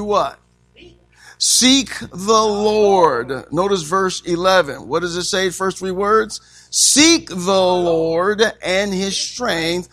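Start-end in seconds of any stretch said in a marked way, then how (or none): 1.4-3.5 very slow, stretched words; drawn-out proclaiming. Acted. Preacher dwelling on the verse for the church, drawn out. 6.7-9.9 very slow, stretched words; drawn-out proclaiming. Acted. Preacher dwelling on the verse for the church, drawn out.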